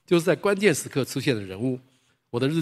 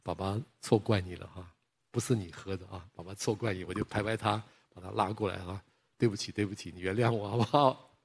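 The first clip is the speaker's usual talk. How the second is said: lower, softer, gentle apology to his son; tender apology; 4 semitones lower, 7 dB quieter than usual. Retold or imitated. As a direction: comfort a crying child and say sorry. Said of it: "哎呀，我就说".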